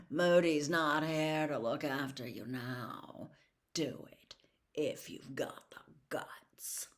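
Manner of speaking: hissed taunt, dropping to a whisper; menacing whisper. A narrator's reading. Malfoy daring Harry to curse him, whispered menace. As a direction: dare him under your breath.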